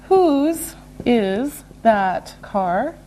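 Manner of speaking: one word at a time; slow and deliberate. Shown as a demonstration. Teacher modelling a possession question, slow and separated. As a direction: stretch out each word with gaps.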